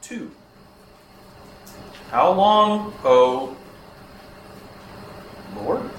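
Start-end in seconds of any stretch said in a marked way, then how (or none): 2.1-5.9 loud, drawn-out cry with long pauses; crying out to God. Shown as a demonstration. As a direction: call out to God in desperation. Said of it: "Habakkuk's crying out".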